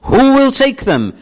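loud high calling out, like an auctioneer; calling out to the meeting. Retold or imitated. Preacher auctioning sins to the meeting, loud calling out. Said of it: "And then he does this".

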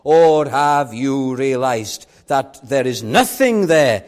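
high, urgent pulpit challenge; full-force preaching. Real, not acted. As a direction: press the congregation hard, rising to a loud climax.

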